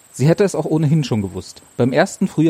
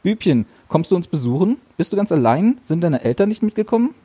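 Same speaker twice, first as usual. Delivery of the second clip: put-on higher, friendly questioning of a small child; lightly voiced girl; 7 semitones higher than usual. Ursel quizzes the little boy, a touch higher. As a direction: ask the little boy gently.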